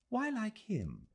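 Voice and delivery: a crisp, curious question; Miss Quested inquiring. A narrator's reading. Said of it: "she inquired, rising".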